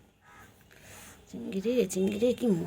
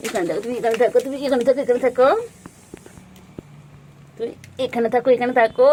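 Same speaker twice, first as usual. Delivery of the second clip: firm stay command; 4 semitones higher, 5 dB louder, faster than usual. Real, not acted.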